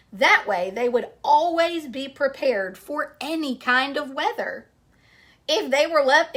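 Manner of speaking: storybook read-aloud to a class; story narration. Acted. Teacher reading a picture book to her class, storytelling voice.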